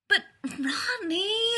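high, drawn-out whine; wheedling, long-stretched plea; whining. A narrator's reading. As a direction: whine it out in protest. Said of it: "he whined".

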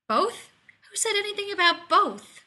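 high, loud panicked yelp; alarmed outburst. A narrator's reading. Rodney alarmed his secret is out, yelping high and loud. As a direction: caught out and panicking.

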